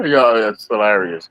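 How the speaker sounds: usual speaking voice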